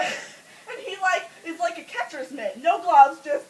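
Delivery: ordinary delivery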